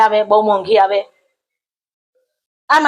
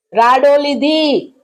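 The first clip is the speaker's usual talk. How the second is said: drawn-out insisting; 5 semitones higher, much slower than usual. Retold or imitated. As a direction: lean on રાડો and draw it out. Friend insists on brand name. (ધીમે ખેંચીને રાડો લીધી)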